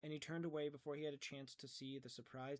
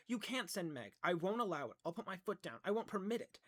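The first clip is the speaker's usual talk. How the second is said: loud, higher, angry refusal; shouted protest; 5 semitones higher, 8 dB louder than usual. A narrator's reading. Calvin forbidding Meg's trip, shouting. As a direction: put your foot down hard. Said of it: "Calvin shouted".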